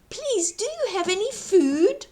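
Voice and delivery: high, pleading little voice; pleading boy. Acted. Jack asking the giantess for food, high and pleading. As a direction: beg politely for food.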